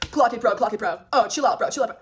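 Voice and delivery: loud, punchy mock hype on a mic. Singer on the mic during fans' calls, loud mock heckling. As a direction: imitate a performer egging on the crowd. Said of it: "Somber's on the mic like".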